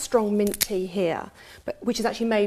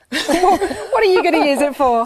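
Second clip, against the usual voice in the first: laughing, loud and high; 7 semitones higher, 9 dB louder than usual. Real, not acted. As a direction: ask it while laughing. Laughing question to cohost.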